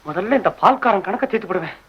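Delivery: ordinary delivery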